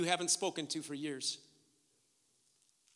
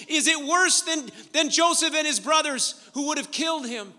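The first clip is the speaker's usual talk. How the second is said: high, loud, urgent exhortation; forceful preaching; 8 semitones higher, 8 dB louder than usual. Real, not acted. Preacher appealing to those estranged from family, forceful preaching. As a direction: press it on every listener.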